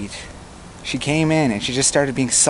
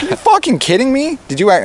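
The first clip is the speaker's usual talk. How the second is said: much higher, incredulous outburst, re-enacted; retold snapping; 12 semitones higher, 5 dB louder than usual. Man snapping at a girlfriend who goaded him, re-enacted outburst. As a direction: act out losing patience, pitch shooting up. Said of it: "that's when I snapped".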